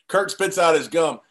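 usual speaking voice

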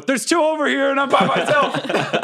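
high shouted call for help; brave yell. Acted. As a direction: yell it over the shoulder, bravely. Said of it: "I'll yell over my shoulder".

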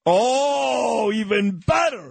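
high-pitched, exuberant acceptance; delighted. Real, not acted. Host accepting a dinner invitation from his guest, delighted. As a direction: gush with delight, pitched well up.

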